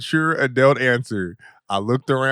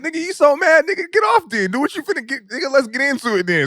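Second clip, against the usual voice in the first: much higher, heated challenge; retold challenge, pitched way up; picking a fight; 11 semitones higher, faster than usual. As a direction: dare him to fight. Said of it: "I was like".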